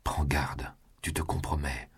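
hushed, unvoiced caution; whispered warning. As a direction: whisper it so no guard hears. Urgent warning to his lover. (avertissement chuchoté)

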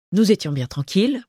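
ordinary delivery, nothing marked

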